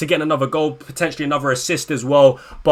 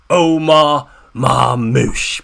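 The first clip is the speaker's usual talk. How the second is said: drawn-out name call; 5 semitones lower, much slower than usual. Real, not acted. Hyping his star player.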